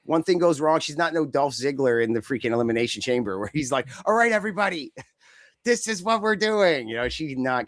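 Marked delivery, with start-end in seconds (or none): 4.0-6.9 higher, take-charge call to the whole ring; rally the room like you're running the show, half laughing; bossy ringleader. Retold or imitated. Dolph Ziggler bossing the other wrestlers, mock commanding.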